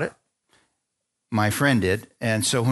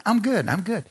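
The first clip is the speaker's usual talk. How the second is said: higher-pitched, hasty brush-off; insistent refusal; 7 semitones higher than usual. Retold or imitated. Man resisting friend's urging, insistently declining.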